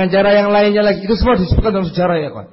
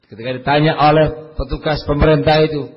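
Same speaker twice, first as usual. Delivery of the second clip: ordinary talk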